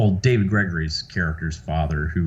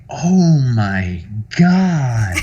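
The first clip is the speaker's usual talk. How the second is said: slow, drawn-out, higher groan of disbelief; mortified disbelief; 4 semitones higher, 5 dB louder, much slower than usual. Retold or imitated. Reacting to his old answering machine message, drawn-out mock horror. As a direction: stretch every word in amused, loud dismay.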